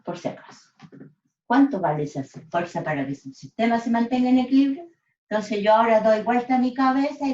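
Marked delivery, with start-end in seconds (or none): 0.0-0.7 breathy unvoiced whisper, just in case; whispered aside. Real, not acted. Teacher whispering a side reminder to the class. 1.4-4.9 faster and louder, stressing cuánto; emphatic repeat. Real, not acted. Teacher re-asking the class the key question, faster.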